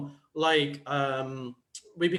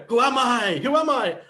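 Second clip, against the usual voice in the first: high, loud film catchphrase; imitated cry of an amnesiac action hero; Jackie Chan; 6 semitones higher, 7 dB louder than usual. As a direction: call out a bewildered movie hero's question. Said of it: "he just goes around going".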